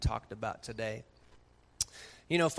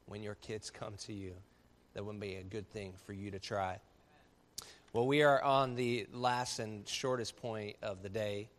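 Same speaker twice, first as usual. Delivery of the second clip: ordinary talk, nothing marked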